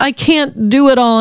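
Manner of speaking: high, slow, tearful plea to the Lord; tearful pleading. Retold or imitated. Overwhelmed mom crying to the Lord over broken bottle, high and slow. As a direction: cry it out, overwhelmed and near sobbing. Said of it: "I just kept saying that".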